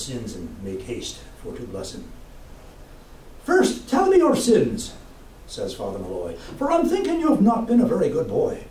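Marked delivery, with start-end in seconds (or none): 3.5-4.9 high, slow priestly questioning; a parish priest demanding confession; mock priest asking for sins; stern priest. Priest voiced in story. 6.6-8.7 a hint of a scolding priest, higher; lightly voiced priest chiding a boy; mock priestly reproach; priest teasing.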